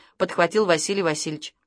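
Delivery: usual speaking voice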